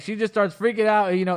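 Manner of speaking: ordinary delivery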